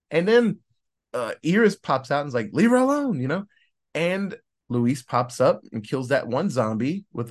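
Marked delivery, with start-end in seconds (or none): 2.5-3.2 higher, louder protective yell; mock shout. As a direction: call out sharply to defend her. Iris confronting zombie.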